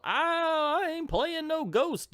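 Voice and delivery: high, strained hammed-up yokel; rough player voice. Reviewer voicing a player who can't see Jay, high mock panic. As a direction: squeal it like a dumbfounded player.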